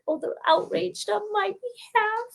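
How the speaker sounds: high, soft little aside; secretly thrilled, small high voice; delighted inner thought. Retold or imitated. Secret delighted thought while crying.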